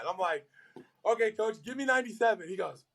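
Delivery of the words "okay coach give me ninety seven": much higher, keen okay coach; eager agreement. Retold or imitated. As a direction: sound like a rookie eager to please. Retold eager reply to coach.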